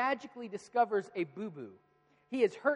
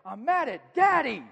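put-on loud, high, drawn-out child's tantrum; angry little boy; 7 semitones higher, 11 dB louder, slower than usual. Upset son blaming his dad, angry little-boy yell. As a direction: mimic a furious kid's slow, high yell.

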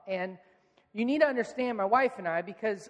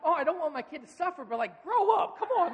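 higher-pitched, torn between pity and impatience; exasperated parent; 7 semitones higher than usual. Retold or imitated. Parent reacting to screaming son, exasperated. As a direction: act out a parent losing patience.

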